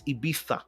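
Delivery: Ibiza said softly as "ee-BEE-tha". Shown as a demonstration. Host gives the corrected form, soft Ibiza as ee-BEE-tha. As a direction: open Ibiza on EE, then TH. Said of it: "spelled with the phonetics of E E".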